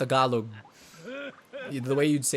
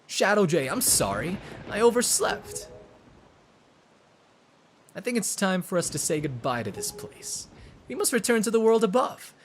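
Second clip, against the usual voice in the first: higher, lilting character voice; apologetic heroine; 7 semitones higher than usual. Acted. Heroine apologising for oversleeping and urging a return, earnest. Reads young heroine's lines.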